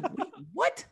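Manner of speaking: high-pitched startled squeak; mock shock. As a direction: imitate a wife caught off guard. Imitates wife's shocked reaction.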